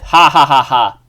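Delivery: a light hammed-up evil cackle of a henchman; mock henchman cackle; mock villain laugh. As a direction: laugh like a gloating thug, half in jest. Henchmen plotting to kill him.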